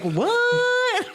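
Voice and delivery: very high, drawn-out "what"; pearl-clutching shock. Retold or imitated. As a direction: squeal it in scandalized disbelief. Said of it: "clutching my pearls".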